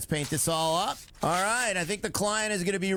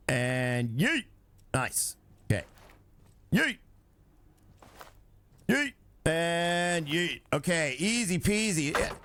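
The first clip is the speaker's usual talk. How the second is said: normal talking voice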